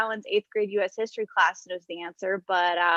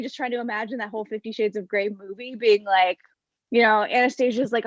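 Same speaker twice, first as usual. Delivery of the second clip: ordinary talk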